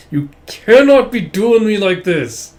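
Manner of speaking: slow, high, loud mock plea; exasperated swooning. Real, not acted. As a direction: wail it in overwhelmed protest. Own mock complaint at series.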